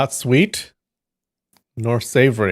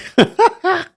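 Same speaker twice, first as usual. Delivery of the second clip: sudden squealing burst of laughter, much higher; 7 dB louder than usual. Real, not acted. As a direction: let a shrill giggle escape at the dish name. Laughing at spotted dick.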